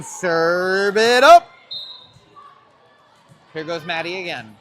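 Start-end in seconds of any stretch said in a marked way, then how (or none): 0.0-1.5 long, drawn-out yell rising at the end; imitated sideline yell. Commentator echoing the sideline's cheer, yelling. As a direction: stretch it loud like a supporter yelling. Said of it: "Sideline yelling".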